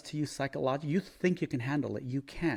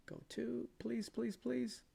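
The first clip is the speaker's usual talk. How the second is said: pleading; 9 semitones higher, 12 dB quieter, slower than usual. Real, not acted. Begging the chart to move.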